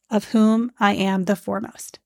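ordinary delivery

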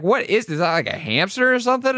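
high, fast, bewildered squeak; imitating Yellow Guy. Yellow Guy asks about the creature, high, fast squeak. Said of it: "And he's like".

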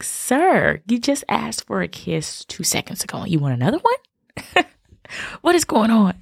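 lightly voiced amused mom; mock exasperated mom. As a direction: tease the kid in a put-upon mom voice. Mom teasing her son about kisses.

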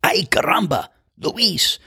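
high whispered Spanish-accented groan at a bad shot; Spanish exclamation. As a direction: whisper it high, like a golfer cursing his own swing. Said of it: "his visceral reaction".

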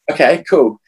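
forced casual. Retold or imitated. Hiding upset, feigning calm.

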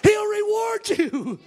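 high, loud, singing preacher's cadence, building to shouts; preaching at full force. Acted. Preacher to his church on faith, rising to a chanted shout. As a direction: preach it up, chanting the lines to a climax.

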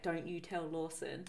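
a hushed, drawn-out caution; retold warning. Warning her to keep the secret, hushed.

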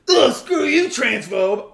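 hammed-up disgusted brush-off; mock angry insult. Mocking a troll with a disgusted, loud brush-off. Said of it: "I'm not like".

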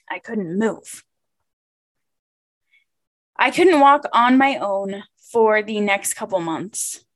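ordinary delivery

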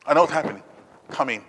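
ordinary talk, nothing marked

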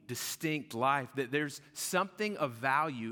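loud and high, driving the verse home; full-force preaching. Acted. Pastor preaching the verse hard to his congregation. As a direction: preach it out to the room.

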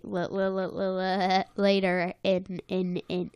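stuttering on the first sound; real stutter. Real, not acted. Explaining later karate to the co-host, stuttering. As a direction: trip repeatedly over "l" and "in". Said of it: "why I had a stutter there".

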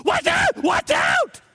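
mock panicked scream, very high; hammed-up high-pitched alarm; nervous passenger. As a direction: shriek a sudden warning from the passenger seat. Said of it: "Susan is one of those nervous types".

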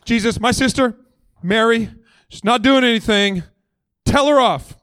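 a sister tattling in outrage; indignant complaint. Retold or imitated. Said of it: "I think she's going".

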